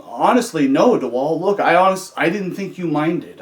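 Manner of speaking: earnest protest. Retold or imitated. Inmate answering officer about phone use, protesting. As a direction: plead your case to the officer, stumbling slightly.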